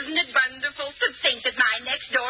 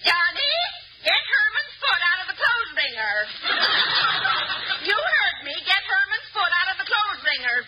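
put-on yelling at kids; 6 semitones higher than usual. Mother yelling at her kids.

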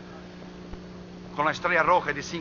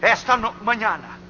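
high, loud exhortation in spaced phrases; forceful preaching; 7 semitones higher, 8 dB louder than usual. Real, not acted. Preacher urges the congregation to return, forceful preaching. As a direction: call them back, punching out each phrase.

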